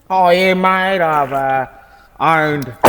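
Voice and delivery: put-on broad bogan customer; old Aussie bloke voice, bogan accent. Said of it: "you went from bogan to ethnic".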